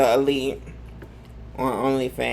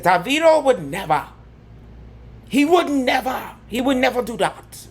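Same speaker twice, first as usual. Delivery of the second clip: high, mock Nigerian accent; hammed-up African accent, pitched up; imitating a Nigerian fan, badly on purpose; 10 semitones higher than usual. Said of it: "I know my African accent is horrible".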